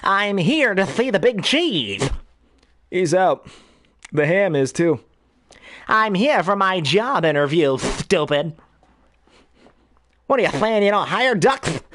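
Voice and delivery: high, lisping cartoon duck; high duck voice with a wet lisp; Daffy Duck. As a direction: sputter it like an indignant cartoon duck. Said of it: "especially as Daffy, is the one I kinda channel".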